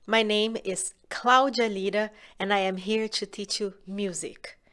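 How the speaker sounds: usual speaking voice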